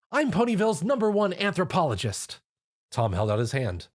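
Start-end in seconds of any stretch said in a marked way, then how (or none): 0.1-2.4 cheerful. A narrator's reading.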